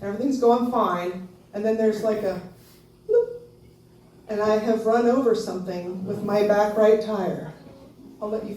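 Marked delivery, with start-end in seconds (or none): 3.1-3.4 short high-pitched "bloop"; little imitated thump, pitched high; bump sound effect. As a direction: pop out a tiny high blip. Imitates car running over something.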